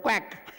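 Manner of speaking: imitated duck answer; duck reply quack. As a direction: quack back as the ducks. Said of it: "the ducks told".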